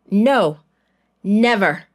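loud, firm no from the father; firm refusal. A narrator's reading. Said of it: "their father responded".

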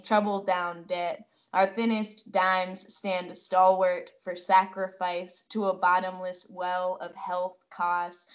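ordinary talk

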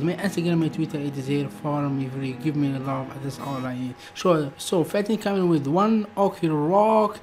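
usual speaking voice

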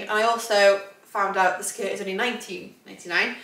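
normal talking voice

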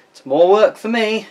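winded after sawing; puffing a little from exertion; out of breath. Real, not acted. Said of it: "you can hear I'm getting a bit out of breath".

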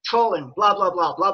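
ordinary talk, nothing marked

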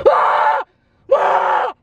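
loud, high fright scream. Retold or imitated. Fan dreading a character's death, loud high shrieks. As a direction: shriek as if your favourite is about to die. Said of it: "I could not stop screaming".

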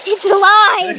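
loud gleeful cry; triumphant shout. Real, not acted. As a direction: cheer it out in triumph. IPad turns back on.

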